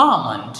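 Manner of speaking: আমন্ড as "aa-mund", no L, falling; correct pronunciation. Shown as a demonstration. Teacher corrects the second syllable for learners, correct pronunciation of আমন্ড. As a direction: let আমন্ড drop low on a weak "mund". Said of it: "মন্ড না".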